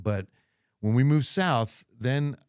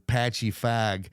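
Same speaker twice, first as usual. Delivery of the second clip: Jersey accent; slower than usual. Shown as a demonstration. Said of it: "So I wouldn't be saying".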